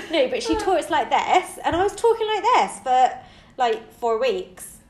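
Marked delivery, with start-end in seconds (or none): 0.4-3.2 lively, higher Bristol accent; playful put-on Bristol twang. Shown as a demonstration. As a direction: demonstrate a Bristol accent, amused. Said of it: "speaking like I was from Bristol".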